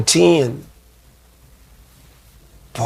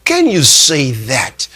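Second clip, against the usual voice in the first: ordinary delivery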